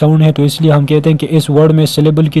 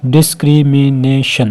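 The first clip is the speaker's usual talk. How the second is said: Discrimination slowly, डिस-क्रि-मि-ने-शन; split into syllables; slower than usual. Shown as a demonstration. Teacher counting five, discrimination slowly split as डिस-क्रि-मि-ने-शन. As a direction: break discrimination into five slow beats. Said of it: "इसमें five है".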